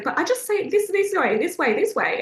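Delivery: high-pitched mock nag, easing down; nagging. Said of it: "that's nagging and that's manipulative".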